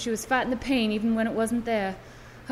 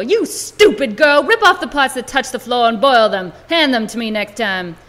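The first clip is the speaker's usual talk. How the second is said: harsh scolding; 8 dB louder than usual. Acted. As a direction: tear into the girl. Midwife scolds girl harshly.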